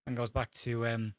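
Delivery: usual speaking voice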